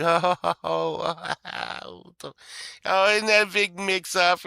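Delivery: sarcastic. Real, not acted. Sarcastic mock surprise at clerks.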